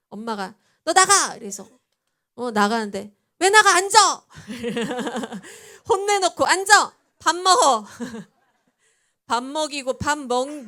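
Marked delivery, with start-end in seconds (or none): 0.8-1.3 높고 크게 버럭 소리치기; 자식을 내쫓듯 크게 호통쳐; 엄마의 호통. Retold or imitated. 엄마가 자식에게 소리침. 3.4-4.3 높고 크게 버럭 소리치기; 자식을 내쫓듯 크게 호통쳐; 엄마의 호통. Retold or imitated. 엄마가 다시 불러 앉힘. 6.5-7.9 높고 크게 버럭 소리치기; 자식을 내쫓듯 크게 호통쳐; 엄마의 호통. Retold or imitated. 엄마가 밥 먹으라 호통.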